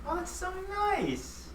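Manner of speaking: touched. Real, not acted. Moved by the gift shirt.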